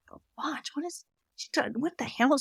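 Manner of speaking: soft, puzzled muttering to oneself; hushed, confused self-talk; baffled aside. Retold or imitated. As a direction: mutter it softly, thrown by what you heard. Baffled reaction to voice in head.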